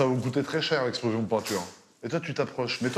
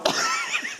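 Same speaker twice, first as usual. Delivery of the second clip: laugh. Real, not acted. Rire sur la montre.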